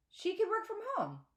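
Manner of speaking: high-pitched insistent plea; insisting. Acted. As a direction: press the point, voice up high. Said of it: "I insist".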